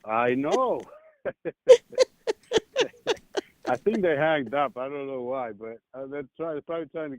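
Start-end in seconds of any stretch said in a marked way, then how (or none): 1.2-2.0 sudden high-pitched burst of laughter. Real, not acted. Laughs at his own joke.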